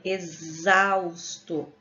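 exausto said very slowly, x as z; slow demo word. Shown as a demonstration. Teacher showing pupils the z sound of x, slowly. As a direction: drag out exausto so the z sound is heard. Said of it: "Aqui tem som de Z".

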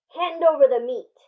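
loud, gruff command; pirate demand. Acted. Braidbeard demands meat at dinner, loud. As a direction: bark it while eating. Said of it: "gulped his food and said".

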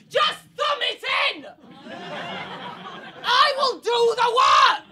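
high, strained yell, words drawn out; desperate mock shout. Pleading with a reluctant partner, desperate mock shouting.

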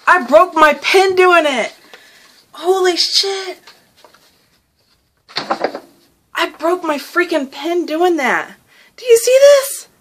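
high-pitched, drawn-out exclaiming; real shocked disbelief. Real, not acted. Vlogger showing viewers her broken pen, shocked. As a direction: build to a loud, high squeal of disbelief.

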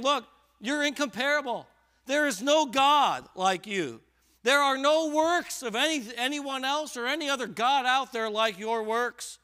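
very high, loud, slow exultant praise; David praising God. Acted. David praising God as incomparable, loud and exultant. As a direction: lift God above every other god. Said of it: "He's saying".

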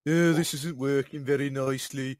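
a lightly put-on Midlands grumble. Mocking Bruce as a Birmingham moaner, light Midlands accent. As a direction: moan like a Brummie in a Midlands accent. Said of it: "stereotypical Midlander, Bruce".

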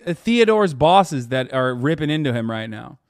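normal talking voice

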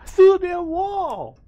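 high, slow, indignant shout; outraged yell. Real, not acted. Shot through wall, outraged.

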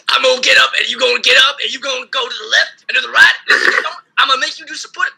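lightly voiced barking sergeant; a hint of a drill sergeant, hurrying the last line; mild mock sergeant bark; fake drill sergeant voice. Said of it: "put on this fake voice".

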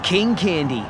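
ordinary delivery, nothing marked

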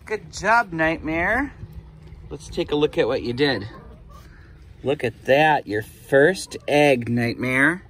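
real proud owner praising her hen; proud praise. Real, not acted. Praising hen's first egg.

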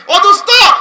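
loud, high-pitched call. Real, not acted. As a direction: hail the congregation with a sudden cry. Preacher calls out to the congregation. (জোরে উঁচু গলায় ডাক)

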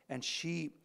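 ordinary delivery

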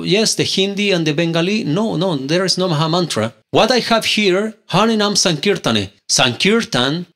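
usual speaking voice

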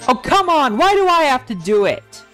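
high, loud complaint; loud, exasperated whine; whining protest. Acted. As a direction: protest an unfair chore at high pitch. Thomas protests a job.

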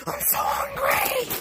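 a starving little squeak of a whisper; breathy high hungry plea; high whispered whine. Acted.

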